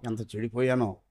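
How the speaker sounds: a broken, almost silent echo; fading lament. Shown as a demonstration. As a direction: let ఎంత చెడిపోయానో die away into a hush. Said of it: "repeated lament".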